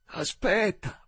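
high, very slow, effortful word; high, struggling drunk voice; labored drunken plea. A narrator's reading. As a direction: drag it out with great effort. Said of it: "mormorò Joe con fatica".

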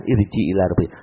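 soft, low inner whisper; whispered reminder. Retold or imitated. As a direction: hush it like a voice inside the heart. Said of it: "ada bisikan".

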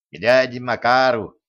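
lightly voiced teenage boy; a hint of a youth's cracking voice; breaking adolescent bass. A narrator's reading. Unseen Ignat answering the captain, breaking adolescent voice. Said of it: "отозвался ломающимся боском невидимый Игнат".

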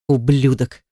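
contained anger, no shouting; seething. A narrator's reading. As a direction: say it through the teeth, understated. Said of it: "процедил Макс".

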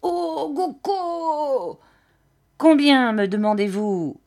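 high wordless cry, then loud demand; gusting old man. A narrator's reading. Old man haggles over a jacket, high cry and demand. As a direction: yelp high, then press the question loudly. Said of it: "toutes ses phrases sur une espèce d'air".

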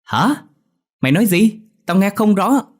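high, loud yell across a distance; loud shout back. A narrator's reading. Dậu to a far-off friend, shouting loudly. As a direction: shout back that you can't hear. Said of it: "thét lớn hỏi lại".

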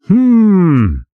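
gruff monster grunt; a huge doorman questions them; rumbling hulk. Acted. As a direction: rumble like falling rock. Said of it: "голосом, похожим на рокот камнепада".

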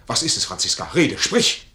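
laut und höher fordernd; fährt sie laut an; drängender Befehl. Acted. Major drängt Franziska zum Reden. (lauter, drängender Befehl)

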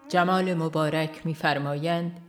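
normal talking voice

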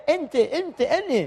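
high playful "ente, ente, ane"; mock Betawi take on Arabic pronouns; light Betawi accent; Betawi-style Arabic. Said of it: "Betawi mungkin ke situ".

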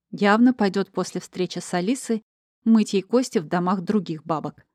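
ordinary delivery, nothing marked

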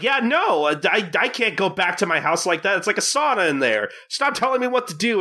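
high, agitated retold complaint; mock irritated outburst. Gin telling off the guy driving next to him, mock irritation. Acting out Gin snapping at driver.

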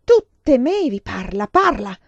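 loud, high, furious interrogation; yelling. A narrator's reading. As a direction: shout demands as if shaking someone. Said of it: "scotendomi urlò".

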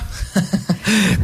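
short teasing laugh, soft; mocking laugh. Host teasing the shaken reporter, mocking laugh. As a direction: laugh at her expense.

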